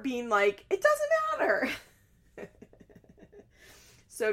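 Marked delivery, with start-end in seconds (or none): 0.7-1.7 very high-pitched imitated shriek; hammed-up screechy mom impression; mock screech. Mom dismissing her daughter's argument, mock screech.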